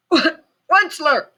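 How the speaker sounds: high croaking cry. A narrator's reading. Lorax crying out to the Onceler, croaking cry. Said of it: "he cried with a kerfuffleish croak".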